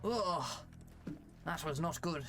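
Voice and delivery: lightly voiced genteel British officer; a hint of a jolly old commander; British gentleman officer. Plays British officer in game.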